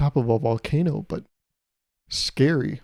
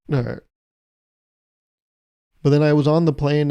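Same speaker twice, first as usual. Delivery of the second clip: ordinary talk, nothing marked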